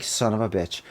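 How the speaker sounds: a hint of a furious father; angry father. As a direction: mutter it like a dad finding his wife. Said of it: "And he's like".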